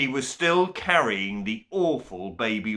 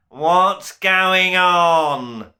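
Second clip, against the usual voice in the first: slow, loud bellow from the doorway; 8 semitones higher, 10 dB louder, much slower than usual. A narrator's reading. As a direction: boom it out as the angry Duke. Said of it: "a voice bellowed".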